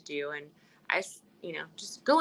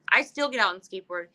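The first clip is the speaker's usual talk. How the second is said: ordinary talk, nothing marked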